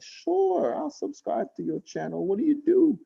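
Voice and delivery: very high, agreeable mimicked voice; imitated store lady. Store lady agreeing to subscribe, high imitated voice. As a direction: jump up high to play the lady. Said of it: "she said".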